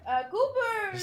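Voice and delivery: high, loud call for help. Acted. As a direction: holler the name to a companion. Character calling out to companion.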